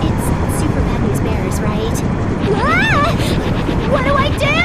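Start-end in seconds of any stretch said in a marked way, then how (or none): 2.5-4.6 high, loud panicked cry; panicked scream. Acted. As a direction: yell it in sudden panic. Panics while falling fast.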